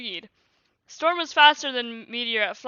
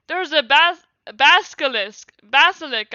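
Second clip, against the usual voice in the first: raised, louder exclamation; mild acted scream; 3 semitones higher, 8 dB louder than usual. A narrator's reading. Violet warning of a basilisk, a mild scream. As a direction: call it out loudly, stumbling on the word. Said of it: "screamed Violet".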